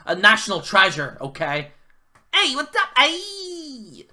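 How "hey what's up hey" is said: high, hammed-up Joe Pesci-style tough guy; mock a wrestler's promo with a high yelp; mock Eddie Kingston promo. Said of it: "That's what he sounds like".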